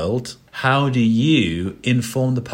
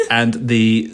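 usual speaking voice

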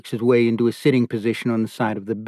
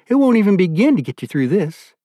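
sharp and louder, pitched higher; sharp scolding wife, louder; 8 semitones higher, 5 dB louder than usual. A narrator's reading. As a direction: press the rebuke harder. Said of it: "Beulah says sharply".

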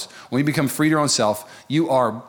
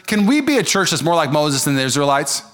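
usual speaking voice

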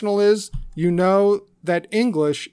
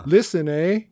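mock Canadian accent. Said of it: "how would you say listen in Canadian".

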